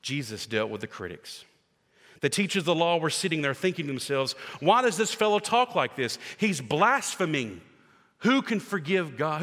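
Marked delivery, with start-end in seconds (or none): 4.6-9.4 higher, louder outraged questioning; indignant scribes. Acted. Teachers of the law objecting to Jesus, high indignant outrage. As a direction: sound scandalised by his words.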